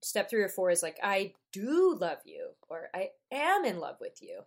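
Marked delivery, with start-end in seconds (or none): 1.0-2.5 "do" stretched long and punched; slow, emphatic "do" in a quoted confession; stress on DO. Retold or imitated. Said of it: "You always emphasize that second syllable". 2.9-4.4 quoted confession with heavy emphasis on "am"; stress on AM. Retold or imitated. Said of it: "You always emphasize that second syllable".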